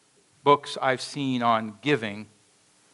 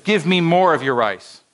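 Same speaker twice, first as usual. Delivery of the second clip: louder, higher demand; firmer demand; 5 semitones higher, 6 dB louder than usual. Acted. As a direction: press him harder for the rice. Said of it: "And the prince said".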